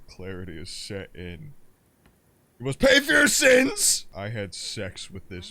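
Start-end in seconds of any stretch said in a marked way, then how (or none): 2.6-4.1 high, loud shriek of judgement; mock righteous yell. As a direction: scream it like a wrathful avenger. Mock punishing shout in game.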